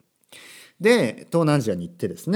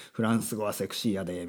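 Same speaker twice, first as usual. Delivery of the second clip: low, softer Kansai-dialect quote; 4 semitones lower, 6 dB quieter than usual. Retold or imitated. Repeating what people say about French, in Kansai dialect. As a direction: lightly imitate a Kansai speaker's intonation. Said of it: "なんで今関西弁になったのか".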